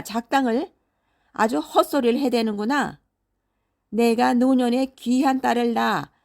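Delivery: loud, high-pitched enraged scolding; storybook father in a towering rage; furious father shouting. A narrator's reading. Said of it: "노발대발하며 소리를 질렀습니다".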